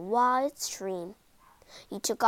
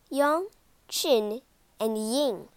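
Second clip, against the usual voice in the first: ordinary delivery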